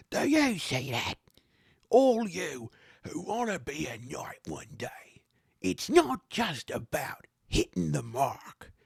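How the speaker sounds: put-on high, slow accented exhortation; rousing knight trainer. Trainer addressing kids who wanna be knights, rousing.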